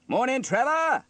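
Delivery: high, loud greeting call; hailing. A narrator's reading. Sam greets Trevor at his bus, calling out. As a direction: hail a friend across the street.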